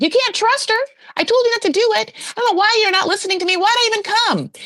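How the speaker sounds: high-pitched mock-outraged mother; [name]. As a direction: scold your son in an exaggerated high voice. [name] ranting in front of Sheena.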